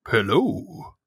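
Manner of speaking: slow, odd villager greeting; cave dweller voice. Acted. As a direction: greet the stranger warily.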